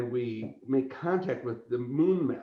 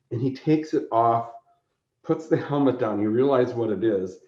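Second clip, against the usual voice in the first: normal talking voice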